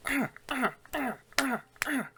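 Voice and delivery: high squawking bird-like ah sounds. Retold or imitated. Umbrella Man squawks at Penguin, high squawking ah's. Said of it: "then just starts going".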